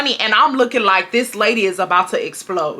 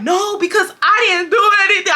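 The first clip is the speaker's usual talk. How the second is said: crying; 10 semitones higher than usual. Retold or imitated. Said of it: "I get the crying".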